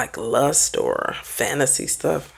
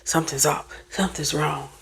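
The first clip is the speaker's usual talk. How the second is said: mocking suspicious commenter; 7 dB quieter than usual. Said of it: "Everything's conspiracy theory".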